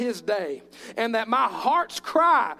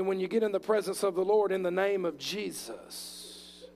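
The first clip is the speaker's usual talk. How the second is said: usual speaking voice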